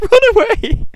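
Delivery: high, loud, laughing it out; squealing with laughter as soldiers flee. Real, not acted. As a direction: giggle through the words. Mocking fleeing soldiers, laughing.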